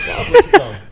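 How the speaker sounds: high, loud outburst; sudden shout. Real, not acted. Answering if his friend slept with his wife, loud outburst. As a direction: blurt it out loud in outrage.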